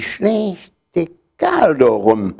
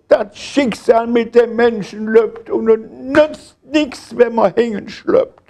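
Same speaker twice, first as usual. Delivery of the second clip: high, self-pitying lament; wailing complaint; 9 semitones higher than usual. A narrator's reading. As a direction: bemoan your fate in a raised, whining voice. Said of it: "Herr Hülden".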